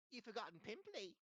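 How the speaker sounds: high, sly impish prompt; hissed taunt. A narrator's reading. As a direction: slip in the insult as a high-voiced imp. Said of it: "hissed in her ear".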